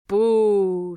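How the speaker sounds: loud jeering boo; the old rat boos Roscuro, loud; shouted boo. Acted. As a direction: jeer at the weeping rat. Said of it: "shouted Botticelli".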